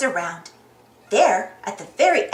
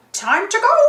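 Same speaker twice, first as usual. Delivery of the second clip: high swooping announcement; high cartoon call to leave; Clarabelle character voice; 7 semitones higher, 4 dB louder than usual. A narrator's reading. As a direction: call it out pitched up.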